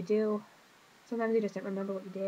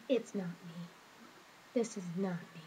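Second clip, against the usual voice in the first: hurt voice; 2 semitones lower, 6 dB quieter than usual. Real, not acted. Vlogger pleads with her viewers, real quiet hurt. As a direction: hold back tears as you repeat it. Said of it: "you can hear it in my voice".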